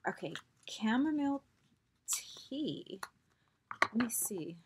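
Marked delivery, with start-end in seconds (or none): 2.1-3.0 whisper. Real, not acted. Whispered word while reading label.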